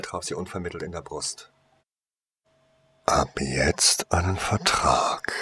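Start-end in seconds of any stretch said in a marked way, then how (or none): 3.1-5.3 vast deep cosmic voice. Acted. Magic beam binds her to him.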